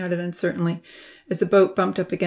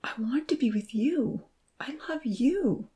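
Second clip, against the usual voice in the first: pleading whisper; 6 semitones higher, 5 dB quieter than usual. A narrator's reading. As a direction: whisper the confession, begging. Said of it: "she pleaded".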